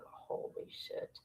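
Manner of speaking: breathy whisper under the breath; whispered holy shit. Retold or imitated. At the doctor's, whispering in dismay. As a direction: whisper it so dad can't hear. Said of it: "I'm like".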